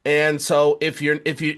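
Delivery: ordinary talk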